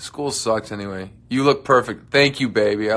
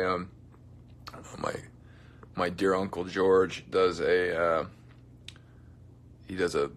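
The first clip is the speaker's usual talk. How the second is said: normal talking voice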